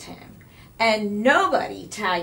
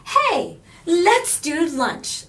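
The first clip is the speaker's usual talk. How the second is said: high, loud, slow excited call; a girl eager to make a lunch date; exclaiming; 9 semitones higher, 7 dB louder, much slower than usual. A narrator's reading. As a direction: bubbly exclaim. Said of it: "exclaimed Carla".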